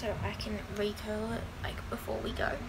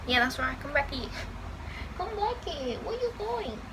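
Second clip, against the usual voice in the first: raised pet-calling voice, climbing higher; calling a pet back; 4 semitones higher than usual. Real, not acted. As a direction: call after a wandering pet.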